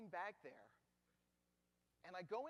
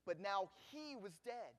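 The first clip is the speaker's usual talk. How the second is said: usual speaking voice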